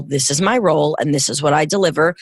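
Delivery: ordinary delivery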